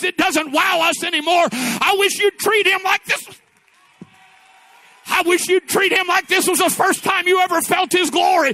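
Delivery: loud, high, impassioned sermon shout; full-force preaching. Real, not acted. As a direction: build to a shouted peak of praise.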